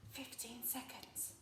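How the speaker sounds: very soft, secretive whisper. Shown as a demonstration. Teacher modelling the shortest time, whispering. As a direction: barely breathe the words. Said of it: "So can you whisper those times".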